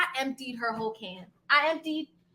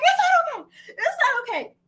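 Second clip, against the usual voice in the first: laughing protest; 13 semitones higher than usual. Retold or imitated. Answering the neighbor's reassurance, a high laughing protest. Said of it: "I'm like".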